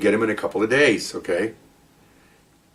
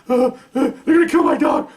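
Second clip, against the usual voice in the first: mock crying; 13 semitones higher than usual. Suspect tells paramedics through mock tears, high whimper. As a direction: blubber it out in a put-on voice. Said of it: "Why are you crying?".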